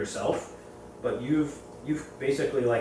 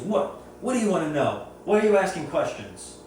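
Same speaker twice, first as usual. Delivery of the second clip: aggressive; 6 semitones higher than usual. Retold or imitated. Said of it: "very aggressive and very confrontational".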